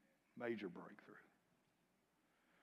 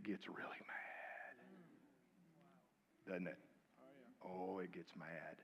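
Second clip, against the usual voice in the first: hushed whisper; 3 semitones lower, 9 dB quieter than usual. Real, not acted. Hushed warning about spirit.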